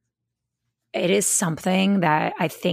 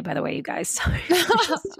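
laughing; 10 semitones higher than usual. Real, not acted. Laughing at own aside.

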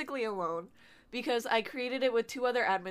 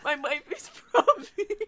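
laughing; 5 semitones higher, slower than usual. Retold or imitated. Mocking repeat of waifu line.

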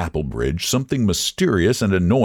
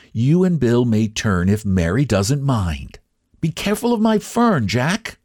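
fond mother; 5 semitones higher than usual. A narrator's reading. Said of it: "the woman's voice went on".

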